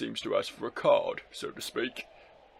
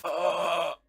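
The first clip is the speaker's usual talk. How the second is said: high, loud acted cry; pained scream; 6 semitones higher, 4 dB louder than usual. Character hurt in a confrontation, high loud cry. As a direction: yell out as if hurt.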